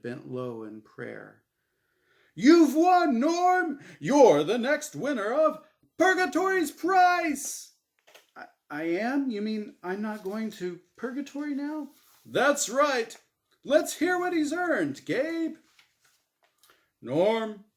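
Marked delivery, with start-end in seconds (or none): 2.3-7.7 very high, loud, thrilled host cry; ecstatic winner announcement. Acted. Game show host Azrael announces Norm has won, ecstatic. 8.3-11.9 higher, stammering disbelief; can't believe his luck; surprised. Acted. Norman asks the host in stunned surprise. 12.2-15.6 loud, high, cheery host voice; game show host. Acted. Game show host Azrael cheers Norm on, loud and bright. Host hands over to Gabe.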